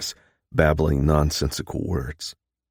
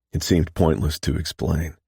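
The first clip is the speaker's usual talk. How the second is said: ordinary delivery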